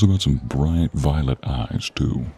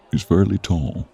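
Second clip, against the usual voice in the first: normal talking voice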